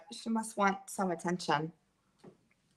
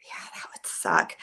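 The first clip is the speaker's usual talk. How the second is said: whisper; 4 semitones lower than usual. Real, not acted. Quiet aside.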